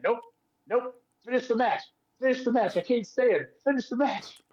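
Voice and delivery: high-pitched imitation of a beaten wrestler; strained refusal. Wrestler laid out in the corner begs off, imitated strained refusal.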